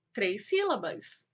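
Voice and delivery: usual speaking voice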